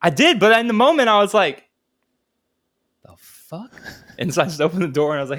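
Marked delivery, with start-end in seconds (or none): 3.0-3.7 barely audible, retold bewilderment; stunned mutter. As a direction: act out a dazed question under your breath. Retold stunned moment after friend fell.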